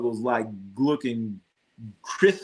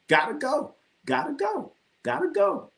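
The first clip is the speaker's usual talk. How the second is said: sing-song urging; 10 semitones higher than usual. Acted. Urging chat to go.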